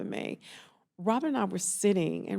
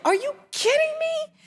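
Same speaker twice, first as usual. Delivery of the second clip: much higher, louder disbelief; big-sister colleague, stunned and much higher; incredulous; 8 dB louder than usual. Retold or imitated. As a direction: shriek of shocked disbelief. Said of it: "She was like".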